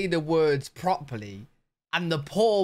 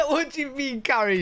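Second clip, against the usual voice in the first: high-pitched, laughing mock bafflement; laughing disbelief; 10 semitones higher than usual. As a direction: ask it incredulously through a laugh. Mock reply to carry me request.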